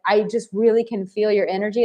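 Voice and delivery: ordinary delivery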